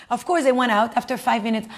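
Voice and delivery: ordinary talk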